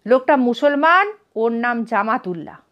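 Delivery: ordinary delivery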